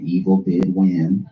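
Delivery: solemn doom, slow; mock-epic slow verdict; dramatic, slow and solemn. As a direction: pronounce it like an epic narrator. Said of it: "dramatic pronouncement".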